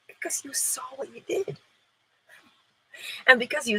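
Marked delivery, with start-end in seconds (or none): 0.1-1.6 breathy hushed whisper. Real, not acted. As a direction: lean in and whisper it. Dramatic aside.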